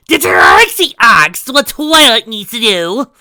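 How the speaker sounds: loud, slow, high indignant snap; snapped. A narrator's reading. Trixie snapping at Spike over Twilight, loud and high. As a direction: bite his head off. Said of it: "snapped Trixie".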